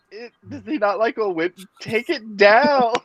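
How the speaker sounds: high, slow, hammed-up diva demand; dramatic demand. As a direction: overdo it like a diva. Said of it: "I was like".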